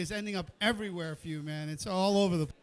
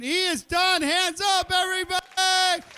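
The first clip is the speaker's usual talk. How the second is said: higher, loud "He is done!"; excited announcement; 8 semitones higher, 3 dB louder than usual. Real, not acted. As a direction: cheer the finish for the room. Chef finishes, host cheers.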